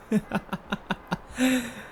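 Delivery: soft, high airy vampire chuckle; a quiet laugh of lofty amusement; light chuckle at the stranger; amused laugh. Acted.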